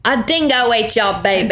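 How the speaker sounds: hammed-up Australian quote; mock Australian accent. Quoting the line from Seinfeld, mock Australian accent. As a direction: put on a broad Aussie accent. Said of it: "that thing from Seinfeld".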